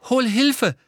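high, loud, anxious voice; alarmed, pitched well up; frightened. A narrator's reading. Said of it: "sagte dieselbe ängstliche Stimme". (ängstlich, hoch und laut)

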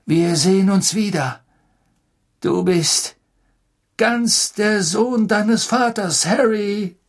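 slow, high, moved goodbye; emotional farewell. Acted. Sirius's heartfelt farewell. (bewegter Abschied)

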